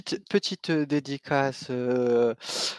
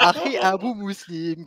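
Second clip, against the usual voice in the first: ordinary delivery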